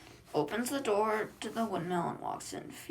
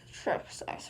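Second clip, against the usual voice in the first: plain reading voice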